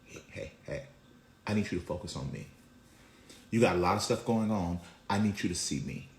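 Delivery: soft, low and intimate; God's gentle call. Acted. God grabbing our faces to get focus, soft and low. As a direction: hush it down, voicing God up close. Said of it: "God is grabbing our faces and saying".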